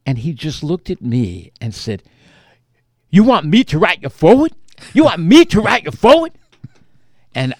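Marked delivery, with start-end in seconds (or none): 3.1-4.5 louder, faster, disbelieving question; incredulous loud question. Retold or imitated. Comedian asked to write the foreword, loud incredulous question. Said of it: "I thought maybe I was gonna get yelled at". 4.9-6.3 much higher, louder, faster repeat of the question; his voice climbs as the disbelief grows; even more incredulous. Retold or imitated. Comedian repeating the request back, higher and louder in disbelief. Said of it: "I thought maybe I was gonna get yelled at".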